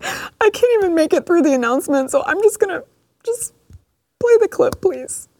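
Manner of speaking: high-pitched, hammed-up tearful voice; mock crying. Host breaking sad news on camera, fake crying. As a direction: pretend to choke up, squeaking through sobs. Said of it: "I didn't mean to cry on camera".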